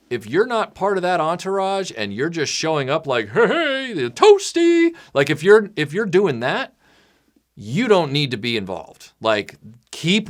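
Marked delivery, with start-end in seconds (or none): 3.3-4.9 high, goofy sing-out; playful high-pitched interjection; mock bystander call. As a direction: pipe up high like an uninvited onlooker. Mimics a bystander showing up.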